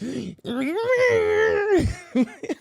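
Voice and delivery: high, drawn-out mock snarl; exaggerated seething whine; seething noise. Mimicking the pissed-off old lady, high seething.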